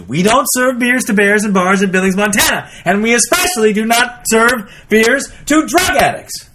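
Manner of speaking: high, emphatic joke bartender at wit's end; hammed-up indignant bartender; exasperated refusal. Fed-up bartender's final refusal in joke.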